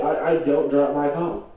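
ordinary talk, nothing marked

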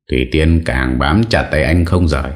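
plain reading voice

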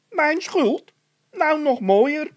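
very high, loud, slow indignant cry; a worker outraged at being blamed; indignant outrage. Acted. Indignant reply in quarrel.

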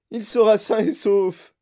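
usual speaking voice

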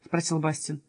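plain reading voice

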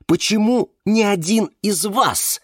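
high-pitched accusing challenge; lashing out. A narrator's reading. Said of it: "накинулась на него Валериана". (наброситься с обвинением)

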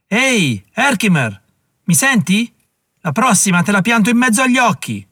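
beffardo, alto e forte; grido minaccioso. Acted. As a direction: urla la minaccia attraverso il bosco. Nemico grida dall'altra parte. (il nemico urla una minaccia a Herkimer)

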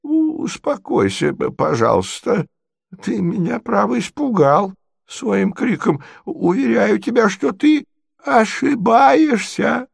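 put-on high, slow, halting old prince voice; doddering prince, soothing. Frightened prince placates his nephew, high and halting.

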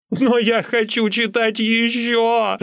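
high, drawn-out desperate yell; pleading high shout; shouting after him. A narrator's reading. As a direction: call after someone vanishing into the dark. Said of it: "крикнул я ему вслед".

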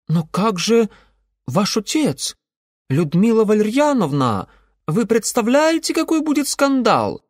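high, loud and flustered; panicked protest, voice rising; alarmed young man. Acted. Ingvar alarmed about scandal.

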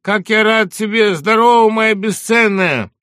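slow, high, loud greeting; joyful shout. Acted. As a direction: beam and call out from the doorway. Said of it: "крикнул с порога".

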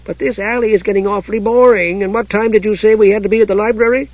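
high-pitched chatty kender, politely bored; bored, chatty Tass. Acted. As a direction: complain politely in a high little voice. Tass voiced in narration.

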